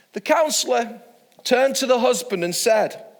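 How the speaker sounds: ordinary delivery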